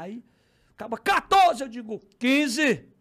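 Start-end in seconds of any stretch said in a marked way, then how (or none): 1.0-1.6 high, loud auction shout; shouted rival bid. Retold or imitated. Acts rival bidder shouting a bid. 2.2-2.9 high, very loud single-word shout; shouted counter-bid. Retold or imitated. Acts own shouted counter-bid.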